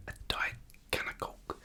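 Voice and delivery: whispered imitation of Scouse; Scouse accent. Naming the diet drink for viewers, Scouse accent. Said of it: "I'm saying a Scouse accent".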